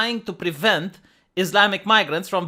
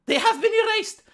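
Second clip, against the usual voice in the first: mock horror; 14 semitones higher than usual.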